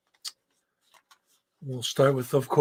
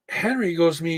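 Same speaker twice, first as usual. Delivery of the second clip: ordinary talk, nothing marked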